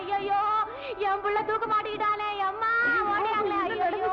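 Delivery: high, staged cries of alarm for the village; fake wailing alarm. Mother fakes panic so neighbours come running, high wailing. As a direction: ham up a mother's panic to draw a crowd. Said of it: "குய்யோ முய்யோன்னு கத்துவேன்".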